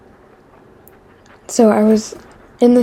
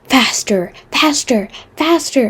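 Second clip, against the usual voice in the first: rising, each faster higher; imitating a kid; 4 semitones higher, slower than usual. Kid on the sled urging her faster, climbing pitch. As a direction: build the pitch up each time. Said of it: "he's like".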